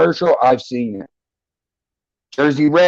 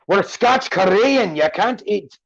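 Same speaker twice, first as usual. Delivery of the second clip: put-on higher, lilting commercial Scottish accent; 5 semitones higher than usual. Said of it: "You remember that commercial?".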